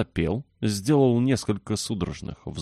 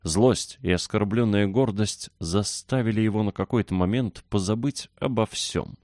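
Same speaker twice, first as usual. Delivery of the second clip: ordinary delivery, nothing marked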